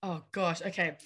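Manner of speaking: ordinary talk, nothing marked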